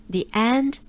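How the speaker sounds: ordinary talk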